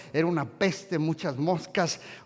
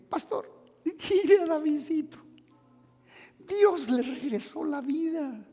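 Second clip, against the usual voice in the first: grateful parent; 11 semitones higher than usual. Retold or imitated. Parent's voice in story.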